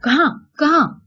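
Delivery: high-pitched, agitated questioning; very angry demand. A narrator's reading. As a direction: demand it crossly, voice leaping up. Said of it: "بے حد غصے سے پوچھا". (غصے بھرا اونچے سر کا سوال)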